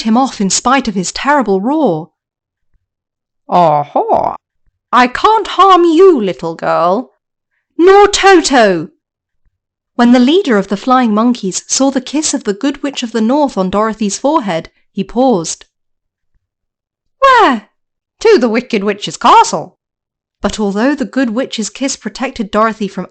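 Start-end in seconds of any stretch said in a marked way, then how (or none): 3.5-8.9 wicked witch. Acted. Witch threatens Dorothy. 17.2-17.7 high, loud monkey voice; monkey leader asking where, high and loud; squeaky monkey question. Acted. 18.2-19.8 monkey answer. Acted.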